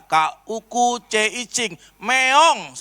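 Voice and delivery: imitating a basic spelling drill; teaching someone to read. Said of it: "Saya pernah ajarin preman baca".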